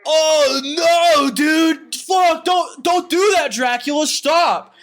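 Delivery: high, loud panicked yell; panicked shouting. Retold or imitated. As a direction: yell at Dracula to stop, frantic. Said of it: "they're like".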